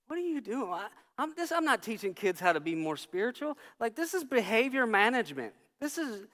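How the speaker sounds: high, softened, incredulous complaint; exasperated. Retold or imitated. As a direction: question it all in dismay. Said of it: "I'm a mess about it inside".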